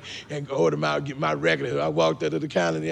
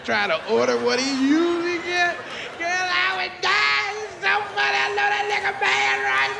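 high squeal building with hilarity; gleeful gossip; 14 semitones higher than usual. Acted. Gift giver to girlfriend imagining him ordering, gleeful squeal. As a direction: squeal as if cracking up at a prank.

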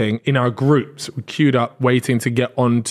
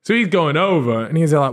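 ordinary talk

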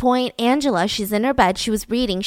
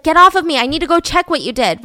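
higher, louder opening command; urgent retold order; 5 semitones higher, 5 dB louder, faster than usual. Girl ordering her attacker off her, urgent. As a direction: push them away with a mock-urgent order.

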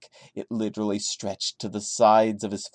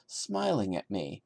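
ordinary talk

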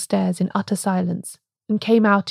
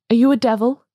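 louder, frightened cry; frightened man cries out at the priest; 3 semitones higher, 3 dB louder, faster than usual. A narrator's reading. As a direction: hurl the question in fear. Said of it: "he cried".

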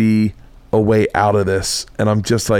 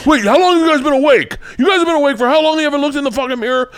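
alarmed big brain; faster than usual. Retold or imitated. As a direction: demand they look in the mirror now. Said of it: "big brain's like".

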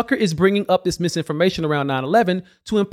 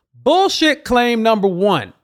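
slow, higher, punchy title read; slow emphatic announcement; 4 semitones higher, slower than usual. Acted. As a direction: announce it like a headline. Title card for each claim.

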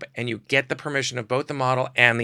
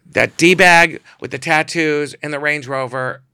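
loud, slow indignant complaint; indignant quote; 5 semitones higher, 10 dB louder, slower than usual. Retold or imitated. Imitating the model complaining about the client. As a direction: spit out the complaint. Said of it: "she says".

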